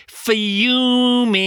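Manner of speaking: mock-furious outburst. Host echoing the angry purists, loud mock fury. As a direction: punch out the word fuming. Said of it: "purists fuming".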